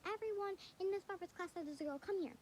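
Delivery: put-on teacher calling girls. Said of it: "she was like".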